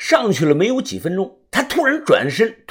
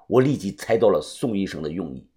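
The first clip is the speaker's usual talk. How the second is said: usual speaking voice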